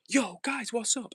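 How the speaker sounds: big YouTuber hello; loud greeting. Retold or imitated. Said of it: "if I was at home in my room, I'd be like".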